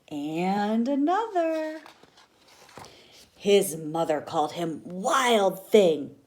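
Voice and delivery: ordinary delivery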